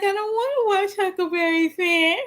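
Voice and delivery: high crying child, mock whine; whiny kid voice. Mom imitating her crying son refusing the movie. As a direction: imitate a kid wailing about a nap. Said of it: "He was like".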